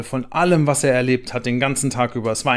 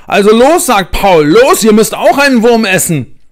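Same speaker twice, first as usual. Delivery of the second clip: insisting; 9 semitones higher, 12 dB louder than usual. A narrator's reading. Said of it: "Paul insists".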